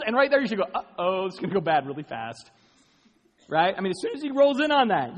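loud, high, driving sermon delivery; full-force preaching. Acted. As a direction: preach it out to the whole hall.

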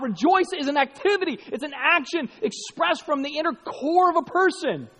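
normal talking voice

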